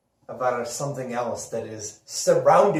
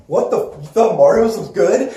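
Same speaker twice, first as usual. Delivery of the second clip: loud, higher, laughing disbelief; mock disbelief; 7 semitones higher, 11 dB louder than usual. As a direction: play the shocked commenter, half laughing. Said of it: "everybody in the comment section right now is like".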